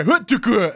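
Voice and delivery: high, loud mock thud noise; a comic impact sound effect; imitated impact noise. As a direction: make the sound of hitting the ground. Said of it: "it said".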